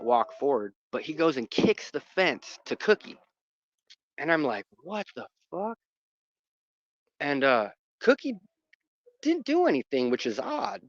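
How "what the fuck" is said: high, softened incredulous reaction; retold disbelief. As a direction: imitate your stunned reaction, high but quiet. Retold shock at kicked fence.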